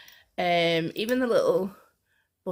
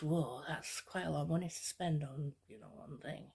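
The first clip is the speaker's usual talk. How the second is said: cautious inner voice; 4 semitones lower, 10 dB quieter than usual. Retold or imitated. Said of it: "part of you that goes".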